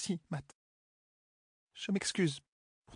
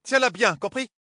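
loud, higher command down the stairs; shouted order; 6 semitones higher, 6 dB louder, slower than usual. Acted. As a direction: bark it down the stairwell. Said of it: "Elle appela à tue-tête". (ordre crié dans l'escalier)

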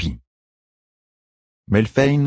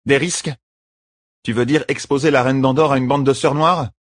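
higher, disbelieving; incredulous queen; 7 semitones higher than usual. A narrator's reading. Queen questions her champion, incredulous. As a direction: challenge the plan.

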